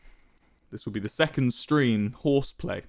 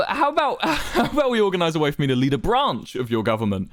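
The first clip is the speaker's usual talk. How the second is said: high, louder nervous laughing plea; begging; 7 semitones higher, 3 dB louder than usual. A narrator's reading. Wilbur begging Quackity for a role, nervous pleading. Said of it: "Wilbur seemed to be begging".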